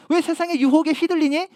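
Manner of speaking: nagging. Retold or imitated. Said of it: "이렇게 잔소리하지 않으세요".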